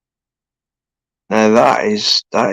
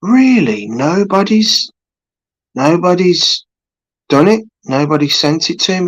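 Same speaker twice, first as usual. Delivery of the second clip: high, incredulous really, nobody's done it; a much higher, disbelieving take on the chat; disbelieving surprise; 7 semitones higher than usual. Retold or imitated. Retelling his shock at the chat, high disbelieving surprise. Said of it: "and I went".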